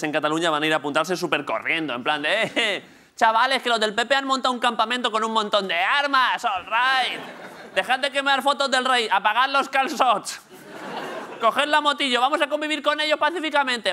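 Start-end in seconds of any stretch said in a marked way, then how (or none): 2.4-13.9 high-pitched mock indepes calling out to the gang; rallying mates. Comic acting out indepes rushing to enlist, high excited call. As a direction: call the lads over, pitched way up.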